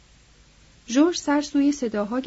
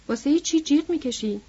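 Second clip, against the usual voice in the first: usual speaking voice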